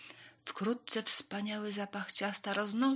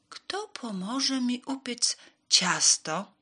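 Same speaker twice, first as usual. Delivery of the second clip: slow, high, loud question; slow raised storybook hen; hen asking for help; 6 semitones higher, 6 dB louder, slower than usual. A narrator's reading.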